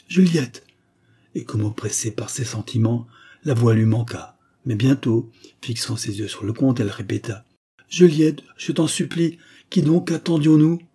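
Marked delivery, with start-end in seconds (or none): 0.1-0.6 high, loud cry of a name; exclaiming. A narrator's reading. Mother grabs her fleeing daughter's hand, crying out. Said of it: "s'écria". 7.9-10.8 high, urgent entreaty; pleading. A narrator's reading. Mother begs daughter to face the count, pleading urgently.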